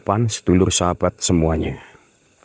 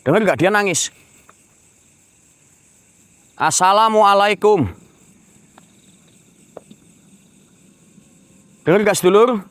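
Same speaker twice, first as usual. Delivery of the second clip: much higher and louder, a far-carrying call; calling out to a spirit; 12 semitones higher, 3 dB louder than usual. Acted. Ghost hunter calling the spirit to appear, loud high calls. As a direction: hail the unseen woman across the dark.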